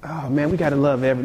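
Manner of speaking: usual speaking voice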